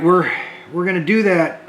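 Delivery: ordinary delivery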